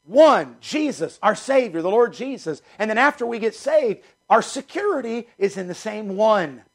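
rising, ringing preacher's call; fervent preaching. Acted. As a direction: lift the congregation toward the Savior. Pastor preaching to congregation.

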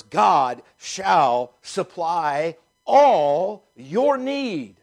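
"God shall supply all" said very slowly, a rise on "all", then up on "your need"; slow emphatic reading. Shown as a demonstration. Pastor to the church, slow stressed scripture reading. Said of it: "I emphasize those three words".

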